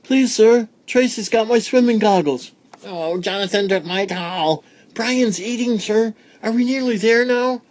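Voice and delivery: put-on high, whiny kid voices; noisy children. A narrator's reading. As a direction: pester like bored schoolkids. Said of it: "the children were now so noisy".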